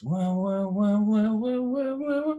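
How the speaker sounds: rising, repeated high wah; high droning wah-wah noise; imitated annoying sound. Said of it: "that stupid sound is annoying".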